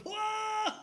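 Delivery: high, loud whoop; excited whoa. Retold or imitated. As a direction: let out a joyful whoa. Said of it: "people were going".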